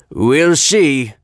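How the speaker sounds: Fat Controller. A narrator's reading. Fat Controller promising Thomas, non-committal. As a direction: promise it in character. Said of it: "promised the Fat Controller".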